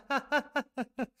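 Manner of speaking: high, loud laugh breaking into the word; burst of laughter. Real, not acted. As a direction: laugh out loud before speaking. Laughs at a player's misfortune.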